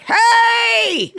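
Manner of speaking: high, loud shout at a crowd; calling out to crowds. Retold or imitated. Decoy in a dog mask shouting to fans, loud high calling. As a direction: yell it out to draw the fans. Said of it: "and I'd be like".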